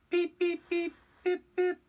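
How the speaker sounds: high piercing beeps like a train whistle; engine whistle. Acted. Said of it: "he whistled".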